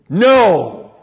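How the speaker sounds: loud, high burst of refusal; emphatic no. Retold or imitated. Preacher quotes God refusing, emphatic shout. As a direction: boom out one sharp no. God's refusal retold in sermon.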